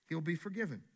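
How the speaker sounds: usual speaking voice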